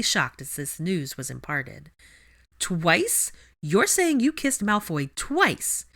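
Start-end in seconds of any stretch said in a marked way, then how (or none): 2.6-5.9 stunned disbelief. Acted. Ginny stunned.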